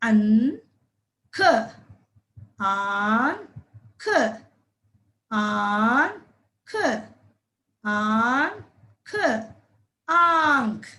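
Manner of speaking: आंख drawn out very slowly, long vowel held; slow sounding out. Shown as a demonstration. Teacher stretching आंख out slowly for children. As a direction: hold the long आ for the kids to hear. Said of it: "दीर्घ स्वर आ".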